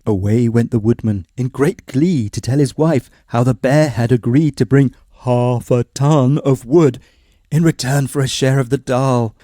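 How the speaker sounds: usual speaking voice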